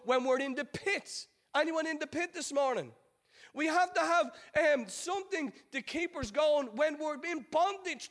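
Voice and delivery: high, driving sermon delivery; impassioned preaching. Acted. Preacher urging the congregation through Joseph's journey, impassioned. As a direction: preach it with rising and falling emphatic waves.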